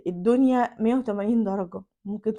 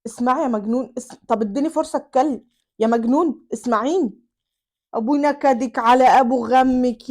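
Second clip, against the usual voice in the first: louder angry husband ranting; 5 dB louder than usual. Acted. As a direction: shout him down, exasperated. الزوج يصرخ على زوجته. (الزوج يصرخ على زوجته بغضب)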